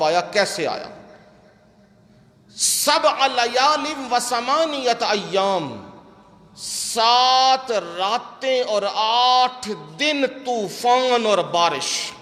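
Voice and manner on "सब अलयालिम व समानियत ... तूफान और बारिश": slow, drawn-out preaching recitation; solemn scripture declamation. Acted. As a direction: stretch each word out like a weighty proclamation. Recites scripture, then declaims translation.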